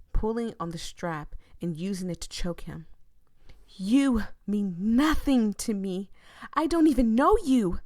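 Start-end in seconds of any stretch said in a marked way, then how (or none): 3.8-7.8 cold fury rising. Acted. Voya raging at Soul while choking him. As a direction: spit it out, building fury.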